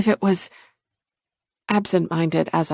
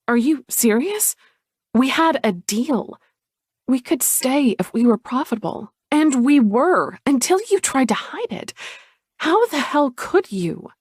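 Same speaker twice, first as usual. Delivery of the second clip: angry; 6 semitones higher than usual. Acted. Sky confronting sister.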